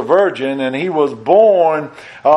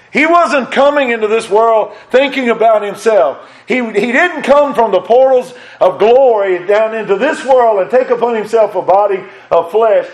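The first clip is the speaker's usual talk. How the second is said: raised, fervent pulpit preaching; climbing sermon fervor, higher and driving; 6 semitones higher, 3 dB louder than usual. Acted. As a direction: lift it into full preaching. Preacher climbs to full force.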